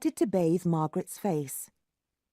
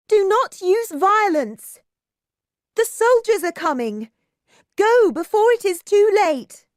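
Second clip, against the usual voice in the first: desperate cry; 12 semitones higher, 8 dB louder than usual. A narrator's reading. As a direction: cry out to stop the crowd. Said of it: "she cried".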